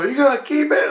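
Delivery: put-on high childish voice, insisting; kids' voice. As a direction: mimic the kids urging him in a high pitch. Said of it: "they go".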